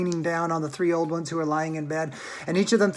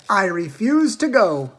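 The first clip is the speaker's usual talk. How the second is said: announcing; 4 semitones higher, 8 dB louder, slower than usual. A narrator's reading. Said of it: "announced Grandpa George".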